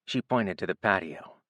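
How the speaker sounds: usual speaking voice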